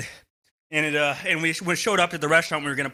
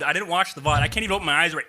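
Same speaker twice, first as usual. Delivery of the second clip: ordinary delivery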